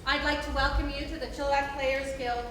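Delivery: usual speaking voice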